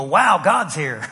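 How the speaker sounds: slow, low mock wonder; dead-air deadpan. Shown as a demonstration. Churchgoer walking into a lifeless service, slow deadpan. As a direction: drag it out with no enthusiasm. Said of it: "it's just like dead air".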